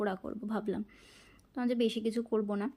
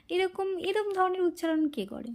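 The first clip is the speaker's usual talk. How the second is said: amused disbelief; 8 semitones higher than usual. Retold or imitated. Laughing complaint to friend.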